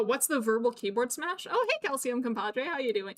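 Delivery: ordinary talk, nothing marked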